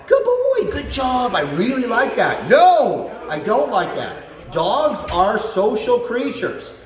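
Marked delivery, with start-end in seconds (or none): 0.1-2.5 put-on very high, gushing "Good boy"; bubbly delighted praise; excited praise. Shown as a demonstration. Trainer showing emotional praise, high and excited. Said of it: "using your emotion". 2.5-4.2 loud higher "No" then firm "I don't like that"; stern disapproval. Shown as a demonstration. Trainer showing negative emotion, stern and loud. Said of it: "using your emotion".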